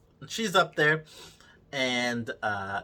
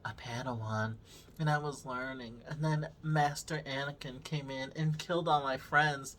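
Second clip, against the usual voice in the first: mock backstory murmur; 11 dB quieter than usual. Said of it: "And she's like".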